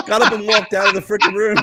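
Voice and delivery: high, quick squeezed-out laughing words; laughing punchline. Real, not acted. Ending the Walken dog story, laughing hard. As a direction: blurt it out while cracking up.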